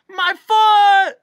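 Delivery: very high, very loud yelp; pained shout. A narrator's reading. Bee struck on the foot, outraged shout. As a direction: cry out in outraged pain. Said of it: "shouted the bee".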